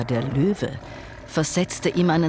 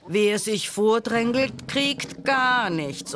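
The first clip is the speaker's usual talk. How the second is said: mild roar, pitched up at the start; understated high bellow of warning; roaring lion; 9 semitones higher, slower than usual. A narrator's reading. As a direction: scold the pushing crowd as lion king. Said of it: "brüllte der Löwe".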